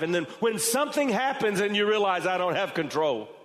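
high, loud building sermon voice; preaching at full force. Acted. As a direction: drive the point home with rising force.

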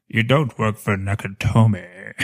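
slow, low Rickman drawl; a mock Rickman villain; Alan Rickman impression. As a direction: do Rickman faking an American. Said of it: "it's Alan Rickman's voice".

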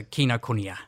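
"Kinokuniya" as kee-no-koo-nee-ya; "Kinokuniya" joined up, starting with kee; correct pronunciation. Shown as a demonstration. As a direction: repeat "Kinokuniya" clearly with every vowel sounded. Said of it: "What is the correct pronunciation".

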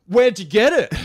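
high, loud call to a stranger; put-on casual question. Confronting the man, pretending to be casual.

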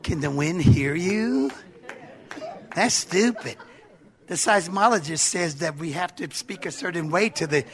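low, soft, condescending doubter; understated scornful objector; scoffing skeptic. Acted. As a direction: question it quietly, like a know-it-all. Plays scoffer doubting the rebuke.

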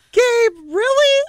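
high, loud, drawn-out disbelief; exasperated sister. Retold or imitated. Sister teasing her brother about his root canal, in exasperated mock disbelief. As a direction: stretch it out, fed up with him. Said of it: "And she's like".

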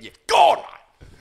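high shouted threat; sudden mock yell. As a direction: voice shoots up loud and high. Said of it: "deep down, he's going".

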